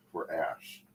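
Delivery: ordinary talk, nothing marked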